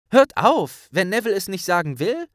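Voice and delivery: high, loud and fast reproach; Hermione cutting in. Acted. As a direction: jump in to defend Neville. Hermine fährt dazwischen. (schnell, hoch und lauter einschreitend)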